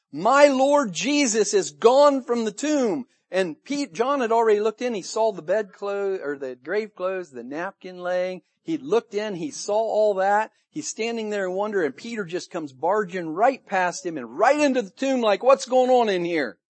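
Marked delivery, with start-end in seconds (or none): 0.1-3.1 loud, high and drawn out; excited exclamation. Acted. Disciple marvelling at the empty tomb, excited exclamation. Acts out an astonished disciple's thought. 15.4-16.6 loud, high, bewildered question; startled demand. Acted. Peter barging right into the tomb, startled demand.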